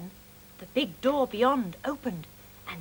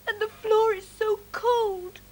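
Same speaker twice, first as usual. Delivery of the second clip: slow, higher, mildly tearful; sobbed; 9 semitones higher, 4 dB louder than usual. Acted. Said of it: "sobbed Mog".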